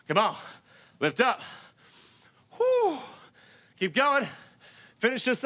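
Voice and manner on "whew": high whew exhale. Real, not acted. Exhaling after a hard set.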